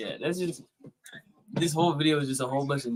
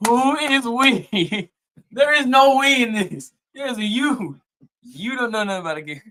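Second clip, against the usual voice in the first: loud incredulous objection; 9 semitones higher, 9 dB louder than usual. Real, not acted. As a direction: shout back in real disbelief, much higher. Streamer heckles the video's narrator.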